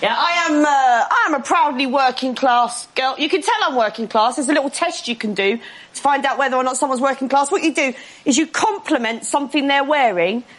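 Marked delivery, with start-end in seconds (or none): none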